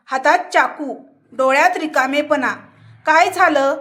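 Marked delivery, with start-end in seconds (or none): none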